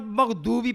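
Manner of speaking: مغضوبی with a plain d for ض; dad turned to d. Shown as a demonstration. Teacher showing some readers' mistake, d in مغضوبی. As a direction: say magh-doobi for مغضوبی. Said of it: "دال سے بدلتے ہیں".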